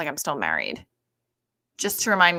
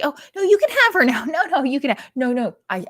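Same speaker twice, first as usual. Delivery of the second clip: high, loud, gushing mock parent; eager parent; 7 semitones higher, 9 dB louder than usual. Mock parent gushing to pass off the kid.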